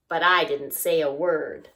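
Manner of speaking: ordinary delivery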